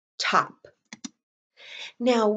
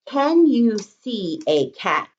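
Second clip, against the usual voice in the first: slow dictation; slower than usual. Shown as a demonstration. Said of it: "The next sentence we're gonna write is".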